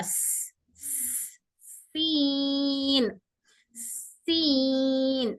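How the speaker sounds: स- hissed voiceless, then सीन drawn out very slowly; slow hissing demo of सीन; airflow s demo. Shown as a demonstration. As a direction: breathe the s out before सीन. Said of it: "तो इसमें भी air flow होगा".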